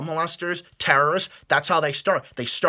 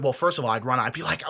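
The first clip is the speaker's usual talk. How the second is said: usual speaking voice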